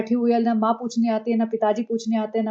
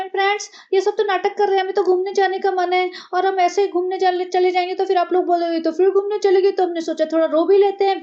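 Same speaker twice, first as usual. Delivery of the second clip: much higher, breathy put-on vlogger voice; mocking vlogger voice; 6 semitones higher than usual. Mocking the vlogger who cries on camera like her sister-in-law. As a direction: ham up a fake teary influencer.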